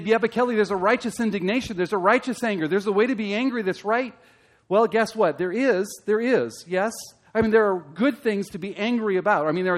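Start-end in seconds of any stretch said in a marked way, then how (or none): 0.0-4.1 objecting listener. Retold or imitated. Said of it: "You say".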